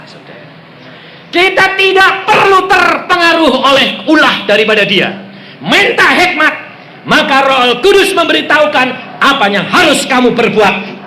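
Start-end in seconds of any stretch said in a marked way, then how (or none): 1.3-11.0 raised, high-pitched exhortation; fervent sermon delivery; forceful preaching. Real, not acted. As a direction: drive it home to the congregation.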